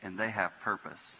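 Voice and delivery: soft, quiet prayer voice. Real, not acted. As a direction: pray it low and hushed, pausing. Closing prayer at the altar.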